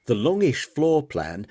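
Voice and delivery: usual speaking voice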